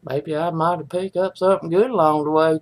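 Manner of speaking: ordinary talk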